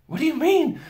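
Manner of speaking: out of breath from the climb; breathless high question; panting. A narrator's reading. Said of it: "panted Peter".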